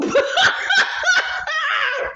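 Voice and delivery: high, shrieking burst of laughter. Real, not acted. Cracking up at the crack insult, shrieking laugh.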